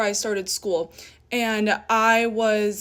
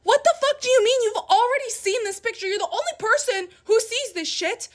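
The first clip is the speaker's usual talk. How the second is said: high-pitched furious retort; incredulous angry yell; 10 semitones higher, faster than usual. Retold or imitated. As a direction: shriek it at him in disbelief. Retold fight with boyfriend.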